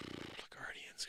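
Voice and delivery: breathy disgusted whisper. Real, not acted. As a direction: drop to a whisper after the sigh. Sighs then mutters team name.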